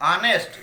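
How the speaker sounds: "ऑनेस्ट" loud, with a clear vowel opening; silent h. Shown as a demonstration. Teacher answering how it is read, "ऑनेस्ट" said loudly from the vowel. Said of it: "शुरू तो हो रहा है आपको आ से".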